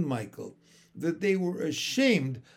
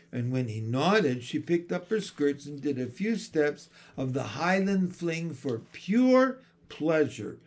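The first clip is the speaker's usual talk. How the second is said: plain reading voice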